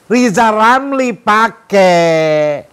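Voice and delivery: loud, high, drawn-out exclamation; emphatic call. Acted. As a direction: call it out like an order, stretching the words. Acted emphatic order to appoint someone.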